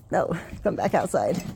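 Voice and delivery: ordinary talk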